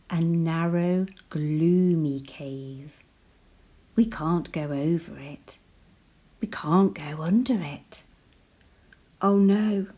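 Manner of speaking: usual speaking voice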